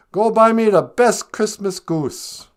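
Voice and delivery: high, loud, slow Scrooge command; hammed-up imperious shout; calling an order. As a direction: call down grandly to an errand boy. Scrooge orders boy from window.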